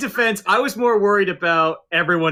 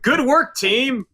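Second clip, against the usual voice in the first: mock cheerful praise; 11 semitones higher, slower than usual. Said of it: "the last sentence goes, like".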